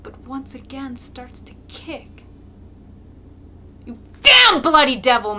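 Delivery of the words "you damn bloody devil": loud, raging curse; furious scream. A narrator's reading. Mokolka cursing the struggling mare in a fury. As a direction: spit it out in pure fury. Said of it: "Mokolka screams out in a fury".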